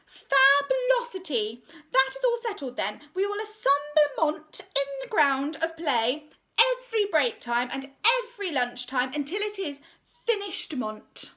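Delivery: preen over the assembly; pompous art teacher. Acted. Art teacher announcing her decision to the school, pompous and high. Miss Conceit settles the plan.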